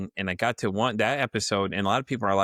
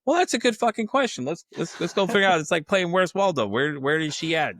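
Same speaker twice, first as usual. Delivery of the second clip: high, sarcastic retort to the new manager; 7 semitones higher than usual. Retold or imitated. He answers the manager sarcastically, high and mocking. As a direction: play it as a mock search.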